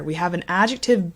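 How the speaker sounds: normal talking voice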